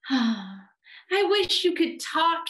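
put-on high, longing child character voice; wistful little boy. A narrator's reading. Eddie wishes his teddy could talk, wistfully. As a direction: wish aloud, high and plaintive.

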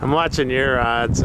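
ordinary delivery

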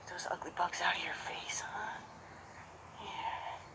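hushed, gentle talk to a horse; soft coaxing whisper; soothing whisper. Real, not acted. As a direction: whisper it while you clean his face. Cleaning the horse's face gently.

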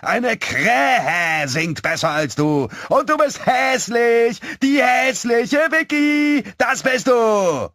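slow, very high, loud mocking yell; braying taunt. A narrator's reading. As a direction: stretch the jeer out at full volume like a braying donkey. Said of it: "eher ein Eselsgeschrei".

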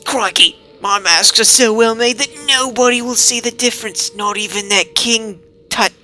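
mock Australian wildlife host, full of bravado; Crocodile Hunter impression. Player voicing the mask seller in a hammy Australian accent. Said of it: "now we gotta do Crocodile Hunter".

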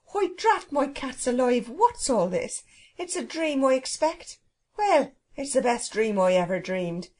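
high-swinging, exclaiming cook with a laugh breaking in; astonished Irish cook. Acted. Cook waking on an island she thinks a dream, astonished. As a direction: marvel aloud as a homely Irish servant.

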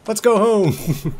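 high, loud, slow rallying cry; excited cheer. Real, not acted. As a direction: belt it out like a triumphant captain. Cheering as he sets sail.

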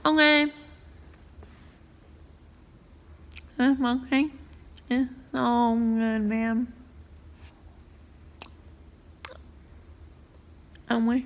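slightly full mouth; eating while speaking; talking between spoonfuls. Real, not acted. Seen 5: spoon lifted to lips between words, likely mouth partly full.

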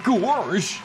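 Goofy. Shown as a demonstration. Streamer showing off a cartoon dog impression, dopey. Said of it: "and now Goofy".

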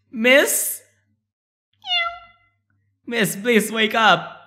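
high voice, questioning; timid in-game heroine. Acted. Calls to an unconscious stranger in game.

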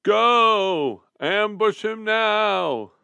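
high, loud, slow command; commanding shout. A narrator's reading. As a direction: order the ambush at full voice. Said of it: "issuing commands through the bars".

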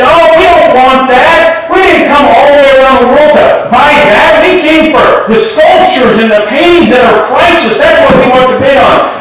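high, mocking voices of scornful collectors; offended art collectors scoffing at the painting; indignant crowd. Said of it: "they said".

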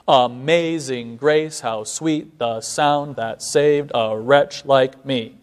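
slow, weak-strong beat, grace stressed; measured iambic recitation. Shown as a demonstration. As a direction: read it slowly, leaning on every second syllable. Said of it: "There's a natural syllabic stress".

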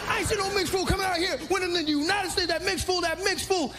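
high-pitched mock stand-up rant; dissing comedian. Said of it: "I remember comedians dissing him".

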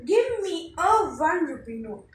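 ordinary talk, nothing marked

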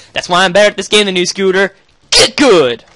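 usual speaking voice

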